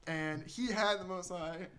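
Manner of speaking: ordinary delivery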